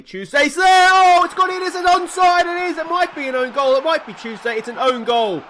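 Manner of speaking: real high-pitched yell as the ball goes in; erupting match commentary; excited goal shout. Real, not acted. Calls a goal as scored.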